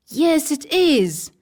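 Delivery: a lightly voiced small boy; little brother nodding along; young boy Michael.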